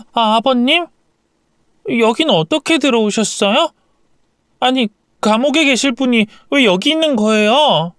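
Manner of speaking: high, stunned questions; can't believe he's here; shocked. A narrator's reading. Wife finds father-in-law out of prison, shocked questions. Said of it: "저와 남편이 고성을 지르자".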